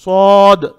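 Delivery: सौद loud and held, with a full heavy s; heavy sad. Shown as a demonstration. Teacher names the letter for students, heavy sad. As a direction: boom out the letter सौद with rounded weight. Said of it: "सौद भी सही पढ़ना है".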